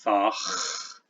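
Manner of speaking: thach ending in a long throaty kh; Old English thach with a drawn-out velar fricative. Shown as a demonstration. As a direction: rasp the ch of thach at the back of the mouth. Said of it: "We have a velar fricative".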